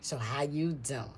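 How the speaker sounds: low, slow, unimpressed greeting; attitude. Retold or imitated. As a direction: imitate a cool, grudging hello. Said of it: "It's always some attitude like".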